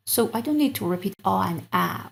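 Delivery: normal talking voice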